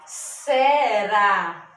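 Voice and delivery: se-rá, two loud, stretched beats; split into syllables. Shown as a demonstration. Teacher showing two syllables in será, sounded out loudly.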